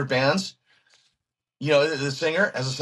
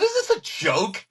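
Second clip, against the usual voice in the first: pitched high in disbelief; half-laughing, sure it's a prank; incredulous; 7 semitones higher than usual. Retold or imitated. Guitarist asking his hero on the phone if it's a joke, incredulous.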